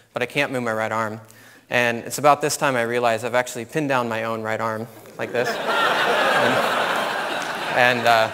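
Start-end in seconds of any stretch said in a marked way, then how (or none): 5.5-6.3 a real high laugh bursting out; high burst of laughter. Real, not acted. Laughing at himself while telling the audience about the snake. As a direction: laugh at your own blunder.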